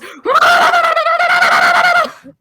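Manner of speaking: long high mock scream gargled through water; underwater screaming. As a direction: scream with your head dunked in a barrel. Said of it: "So all she hears is".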